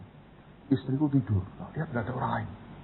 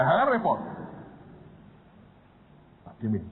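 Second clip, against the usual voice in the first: ordinary talk, nothing marked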